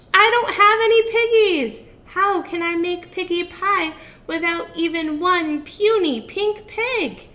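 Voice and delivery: high, loud, upset witch; witch rant. Acted. Witch ranting to herself about no piggies. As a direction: wail it in high frustration.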